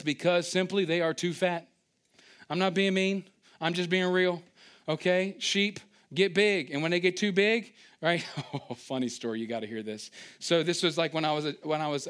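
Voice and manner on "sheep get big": normal talking voice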